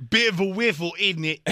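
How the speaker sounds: hammed-up bad English accent; badly faked English accent; terrible English accent. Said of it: "it was a terrible English accent".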